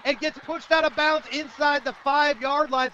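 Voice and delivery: high, shouted countdown to the goal line; excited breakaway call. Real, not acted. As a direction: keep shouting until he is pushed out. Calling a breakaway run live.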